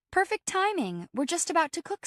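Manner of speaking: high-pitched, cheery announcement; bubbly friend. Acted. Friend tells housemate they are about to cook, bubbly. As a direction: sing out the good news, high and eager.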